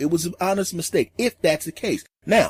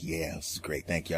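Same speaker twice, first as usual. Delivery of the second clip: near-silent, lower mouthed thanks; silent thank you; 11 semitones lower, 14 dB quieter than usual. Retold or imitated. The comedian quietly thanks the staring crowd, near silent. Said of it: "the silent thank you".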